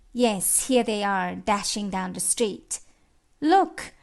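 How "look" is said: high, sudden call of alert; excited cry. Acted. Onlooker spots the racing horses, high excited cry. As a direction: cry out to make everyone look.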